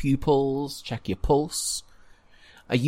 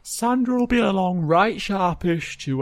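high, louder awkward official voice; guard character; 8 semitones higher, 4 dB louder than usual. Acted. Guard tells the injured visitor to get comfortable, officious. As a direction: put on an officious, hesitant guard.

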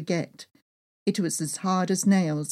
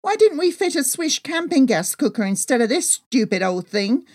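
raised, higher exasperated voice; loud enough to be heard over the maps; frustrated wife complaining loudly; louder complaint; 6 semitones higher, 5 dB louder than usual. Acted. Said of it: "I said even louder".

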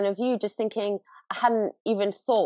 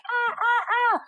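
happy scream. Retold or imitated. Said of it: "she continues going like".

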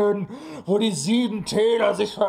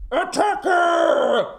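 high shouted war cry; battle cry; 10 semitones higher than usual. Acted. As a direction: charge with a yell.

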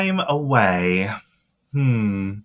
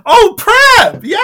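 high, loud "oh, prep!"; sudden realisation; 10 dB louder, slower than usual. Real, not acted. Co-host suddenly remembering the topic, loud and high. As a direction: burst out as it clicks.

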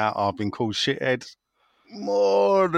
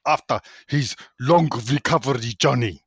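a shaky attempt at a German accent. Reading a listener's question aloud in a mock German accent. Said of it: "read this in a German accent".

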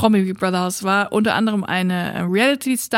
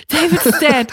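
louder, higher, shocked echo; alarmed repeat; 4 semitones higher, 4 dB louder than usual. Retold or imitated. Housemates pass on the news, louder and alarmed. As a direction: blurt it out in alarm.

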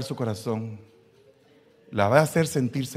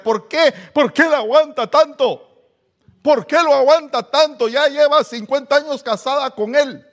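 much higher, loud, shrill-toned outrage; indignant gossips; 11 dB louder than usual. Retold or imitated. Critics asking why she puts up with him, loud outrage. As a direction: cry out like scandalized neighbors. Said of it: "algunos te podrían criticar".

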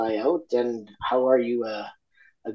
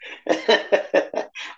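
short airy laugh; breathy laugh; 9 semitones higher than usual. Real, not acted.

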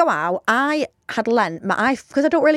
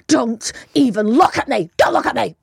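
mock furious telling-off. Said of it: "I was so horrible".

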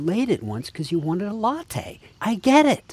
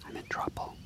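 whisper; 11 dB quieter than usual. Acted. Confessing to partner, caught out.

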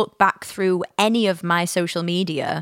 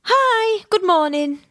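very high, bright, sing-song phone hello; fake-cheery robot voice answering the phone; eerily chipper high-pitched hello; chirpy robotic greeting; 13 semitones higher, slower than usual. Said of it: "if I rung you like that".

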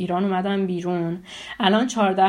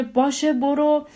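higher, clipped dismissal; mock angry uncle; 6 semitones higher, slower than usual. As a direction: imitate the uncle shooing her off. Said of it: "شروع کرد داد زدن سر من". (عموی عصبانی با لحن تند «باشه، برو»)

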